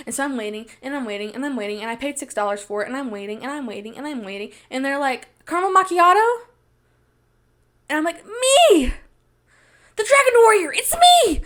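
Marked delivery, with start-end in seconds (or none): 0.1-4.5 very soft, repetitive murmur; tedious waiting. Acted. Acts out an endless wait. 5.5-6.4 high, questioning order call; barista calling out. Retold or imitated. 8.3-11.4 very high, hammed-up squeal; over-eager claiming. Acts out claiming the drink.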